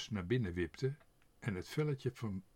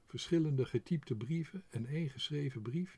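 plain reading voice

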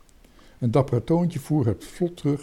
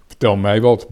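loud grumble at usual pitch; grumbling; 10 dB louder than usual. A narrator's reading. Gijs answering the little captain's friends, loud grumble. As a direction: grumble it out with force. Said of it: "bromde Gijs".

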